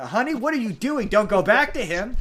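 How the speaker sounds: high, sassy plea to a friend making a mistake; pleading with her. Retold or imitated. Viewer urging the boxer not to return, high and sassy.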